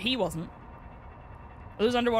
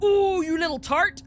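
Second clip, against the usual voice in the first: slow, loud, higher mock scolding; sassy cat voice; 9 semitones higher, 7 dB louder, slower than usual. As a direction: drag out the insult like a catty diva.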